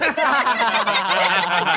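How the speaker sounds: short amused laugh. Acted. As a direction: chuckle at it. Amused at word.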